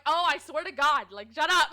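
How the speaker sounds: loud retold outburst breaking into a laugh; exasperated. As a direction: act out being fed up, loudly. Fed up with hounding manager, retold.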